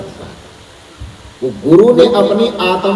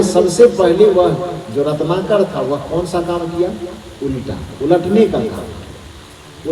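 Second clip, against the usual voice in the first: usual speaking voice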